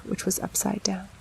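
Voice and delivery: ordinary delivery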